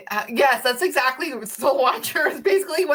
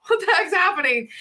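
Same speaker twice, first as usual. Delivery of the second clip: high, alarmed retelling of being scared; retold alarm; 8 semitones higher than usual. Mother retelling scare of daughter's breathing attack, alarmed. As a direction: replay a mildly panicked reaction, pitch up. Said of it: "it was freaking scary".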